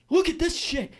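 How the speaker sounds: high-pitched indignant imitation; mock outrage. Storyteller imitating houseguest finding old texts, high mock outrage. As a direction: mimic her disgusted outburst up high. Acted outburst of the angry houseguest.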